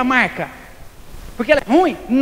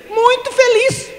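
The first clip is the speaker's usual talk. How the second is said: emphatic answer; 11 semitones higher, 4 dB louder, slower than usual. Real, not acted. Answering own question emphatically.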